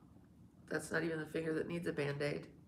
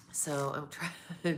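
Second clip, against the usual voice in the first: ordinary talk, nothing marked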